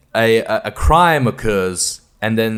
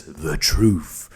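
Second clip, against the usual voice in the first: low, drawn-out title card reading; mock-ominous; 8 semitones lower, 4 dB quieter than usual. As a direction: intone it low, with mock gravity. Reads out the dramatic title card.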